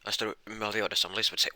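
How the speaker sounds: usual speaking voice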